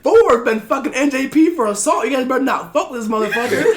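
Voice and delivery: high, loud hyped bragging; hyped boast. Retold or imitated. Marines bragging about Ford's charge to others, hyped laughing boast. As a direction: hype him up to the others.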